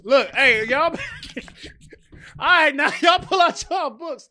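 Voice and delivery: loud, high, hammed-up classroom teacher yell; mock teacher yelling. Teacher cooking noodles yelling at the class, mock shout. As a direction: bark at the class like a frazzled teacher. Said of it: "The teacher be yelling".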